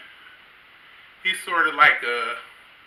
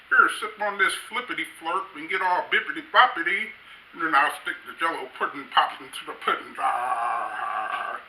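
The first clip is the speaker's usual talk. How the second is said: lightly voiced Cosby, mumbly and sly; sly comic Cosby offering a spiked drink; Bill Cosby impression. Comedian's Cosby impression, spiking a drink, sly and mumbly. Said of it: "That's my, um, Bill Cosby impression".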